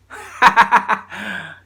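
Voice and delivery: loud, high burst of laughter; sudden laugh. Real, not acted. Poet botching the introduction to the next poems, bursting out laughing. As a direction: crack up at your own slip.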